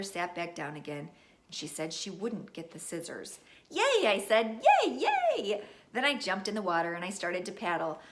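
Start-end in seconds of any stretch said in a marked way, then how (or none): 3.7-5.6 cheering. Acted. Child cheering.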